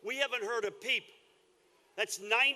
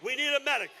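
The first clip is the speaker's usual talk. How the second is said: man down shout; 3 semitones higher, 4 dB louder, faster than usual. Real, not acted. Calling for help, someone collapsed.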